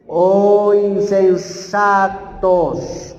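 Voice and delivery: slow, loud, drawn-out reproach; stern rebuke. Acted. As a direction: rebuke the foolish disciples slowly and heavily. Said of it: "ahí viene la reprensión". (reprensión lenta y fuerte)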